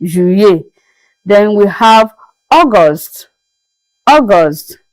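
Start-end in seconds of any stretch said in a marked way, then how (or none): 0.0-0.6 Juillet as "zhu-YAY"; French pronunciation. Shown as a demonstration. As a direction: say Juillet with the double L as a Y. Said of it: "as if you are pronouncing".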